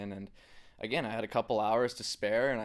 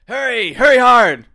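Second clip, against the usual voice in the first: high, loud hurry hard shout; curling skip yelling; 13 semitones higher, 21 dB louder than usual. Shown as a demonstration. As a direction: bellow it down the sheet. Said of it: "your best, uh, hurry hard to the camera".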